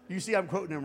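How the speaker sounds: ordinary talk, nothing marked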